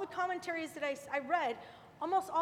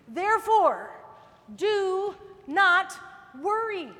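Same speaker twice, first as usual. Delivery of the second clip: louder, ringing therefore do not worry; emphatic proclamation; 7 dB louder, slower than usual. Acted. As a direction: declare it to the congregation. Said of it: "preaching emphasis".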